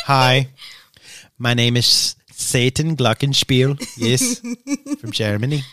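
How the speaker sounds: hammed-up German villain voice; German accent. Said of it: "That's me trying to do a German accent".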